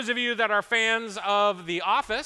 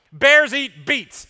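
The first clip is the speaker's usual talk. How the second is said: loud deadpan; 6 semitones higher, 10 dB louder, much slower than usual. Retold or imitated. Jim mocking Dwight, pressing on.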